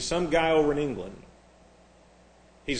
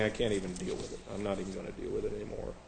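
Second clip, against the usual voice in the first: much softer, fast, fed-up mutter; disgusted aside; 8 semitones lower, 13 dB quieter, much faster than usual. Real, not acted. Preacher giving up on the nauseating translation, disgusted mutter. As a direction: drop it under your breath in disgust. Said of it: "it's so nauseating".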